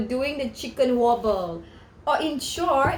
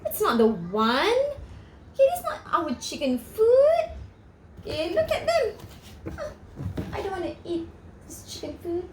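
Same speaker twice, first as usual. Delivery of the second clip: sniffy attitude; 6 semitones higher than usual. Acted. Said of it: "They're giving him the attitude".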